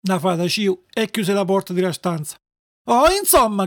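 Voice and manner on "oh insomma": higher, mildly tearful outburst; exasperated cry. Acted. Puppet snapping at the fairy, exasperated cry. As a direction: cry out, fed up. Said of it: "gridò Pinuccio scoppiando a piangere".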